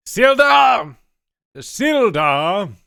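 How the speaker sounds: high, loud shouts of a name; calling out. Acted. Dwarf shouting for Sildar to come. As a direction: call across the room.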